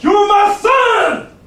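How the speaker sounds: loud, slow, high cry of recognition; joyful shout. Retold or imitated. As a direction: shout it out slowly, overjoyed. Father eagle finds his son.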